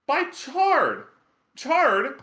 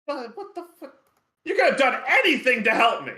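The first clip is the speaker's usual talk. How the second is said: indignant imp; 9 semitones higher, faster than usual. Acted. Chard scolding the party.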